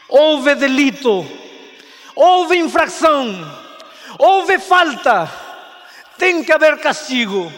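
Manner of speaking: mother preaching justice; solemn loud declaration. Acted. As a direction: proclaim the law with force. Mother declares punishment must come.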